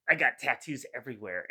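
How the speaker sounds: ordinary talk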